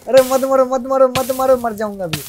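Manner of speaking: high, loud repeated plea; panicked pleading. Real, not acted. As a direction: beg urgently to be spared in the game. Pleading while being attacked in game. (घबराकर गिड़गिड़ाते हुए)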